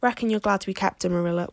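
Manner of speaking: ordinary delivery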